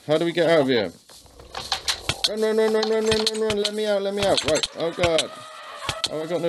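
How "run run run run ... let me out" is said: panicked shouting. Real, not acted. Fleeing porcupines in game.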